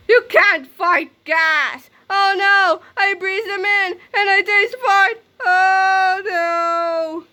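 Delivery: high, loud, slow horror; hammed-up panic. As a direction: choke and wail comically. Villain panicking in gas.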